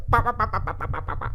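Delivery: light chattering bah bah; mocking chipmunk babble. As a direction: tease the critics with babble. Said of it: "the voice inside my head".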